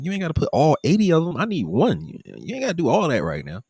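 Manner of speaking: rushed mock protest; urgent protest. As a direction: hurry to cut them off, voice up. Telling staff to slow down.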